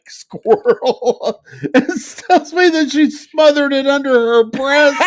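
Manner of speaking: words squeezed out through helpless laughter; can barely get it out for laughing; laughing while telling. Real, not acted. Host cracking up while telling cohost the squirrel story, laughing.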